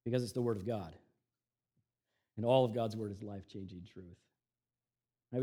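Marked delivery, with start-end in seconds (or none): none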